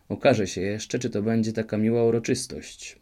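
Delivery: plain reading voice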